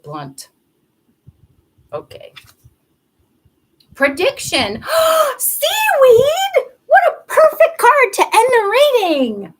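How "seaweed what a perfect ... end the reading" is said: high, loud excited cry; delighted gasp. Real, not acted. Drawing the last card for viewers, delighted shout. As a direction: burst out with delight.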